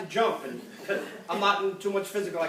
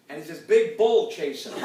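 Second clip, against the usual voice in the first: normal talking voice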